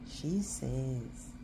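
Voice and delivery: usual speaking voice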